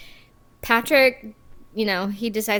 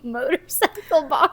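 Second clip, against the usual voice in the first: slow, higher put-on "Motorcycle bar"; odd playful voice; 6 semitones higher than usual. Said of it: "What was wrong with that?".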